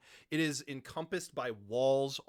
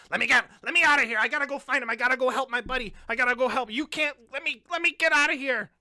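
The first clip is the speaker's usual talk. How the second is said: high, loud, fast panicked pleading; a little gnome fighting to break free; shrill-free frantic gnome begging to get out; 10 semitones higher, 10 dB louder, much faster than usual. Acted. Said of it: "You hear".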